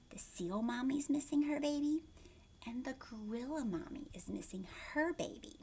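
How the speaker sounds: ordinary delivery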